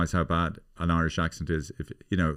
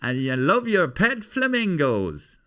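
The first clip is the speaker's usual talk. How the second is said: high, loud, insistent comic stalker; Tommy Nicholson; 9 semitones higher, 4 dB louder than usual. Acted. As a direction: confess it proudly in a squeaky Irish voice. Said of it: "That's Tommy Nicholson".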